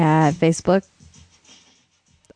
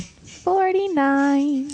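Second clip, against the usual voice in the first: echoing announcer; 6 semitones higher, much slower than usual. Acted. Echo of episode number.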